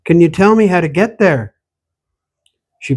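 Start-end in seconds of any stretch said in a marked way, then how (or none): 0.0-1.5 raised voice, calling after her; louder urgent repeat. Acted. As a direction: call it after her as she walks off. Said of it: "Wait," he said".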